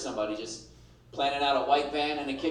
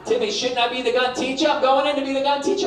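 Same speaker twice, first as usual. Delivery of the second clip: higher, louder eager wife voice; 8 semitones higher, 7 dB louder than usual. Retold or imitated. Wife pleading to be the gun teacher, excited and loud. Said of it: "She goes, like".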